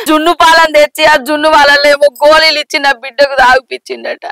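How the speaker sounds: sobbing, plaintive account with curses; grieving mother's wail; tearful lament. Acted. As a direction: tell the wrong through tears. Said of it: "ఏడవకు అత్తమ్మ".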